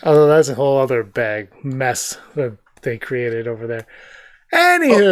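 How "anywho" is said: high, loud "moving on" interjection; breezy topic change. Real, not acted. Host steering away from the mess they created, breezy. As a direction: brush the subject aside, pitched high and loud.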